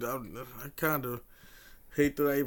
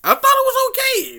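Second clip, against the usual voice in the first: a very high, louder squeak; squeaky defense; 10 dB louder than usual. Real, not acted. Learning Reboot was Canadian, squeaky defensive voice.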